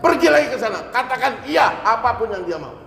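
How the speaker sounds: high, loud order barked at the envoy; angry command. Retold or imitated. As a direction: send him back with force. Umar orders him back. (Umar memerintah dengan lantang dan marah)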